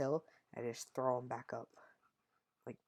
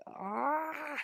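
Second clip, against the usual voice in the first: yelp of pain; 14 semitones higher than usual. Retold or imitated. Kid yelps at fall injury.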